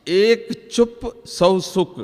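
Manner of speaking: slow, deliberate quoted saying; quoting Baba slowly. Retold or imitated. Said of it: "बाबा की ये बात". (बाबा की बात धीमे और ठहरकर)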